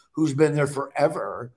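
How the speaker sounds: ordinary talk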